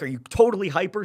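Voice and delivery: high and loud, bouncing with glee; hyped question. Retold or imitated. Coach to friend cheering in the stadium, loud hyped ask. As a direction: sound as hyper as the fan. Asking about peak excitement.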